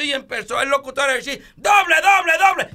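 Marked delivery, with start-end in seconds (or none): 1.6-2.7 high, loud radio announcer yelling the hit; announcer call. Acted. Radio announcer calling Manny Ramírez's hit, shouting excitedly.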